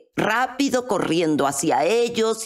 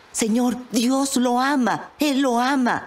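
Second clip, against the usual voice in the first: slower, higher, fervent appeal; retold fervour; 5 semitones higher than usual. Woman grabbing a policeman to tell him, fervent. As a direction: press the good news on him earnestly.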